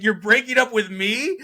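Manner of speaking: high, loud imitation of a crying man; hammed-up hurt, asked in disbelief; lightly played weepy outrage at being dumped; mock tearful disbelief. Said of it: "the guy looks at him crying".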